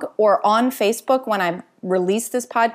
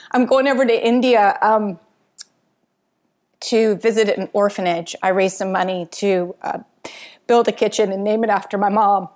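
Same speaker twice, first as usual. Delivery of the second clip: getting emotional. Real, not acted. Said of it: "I'm getting a little emotional".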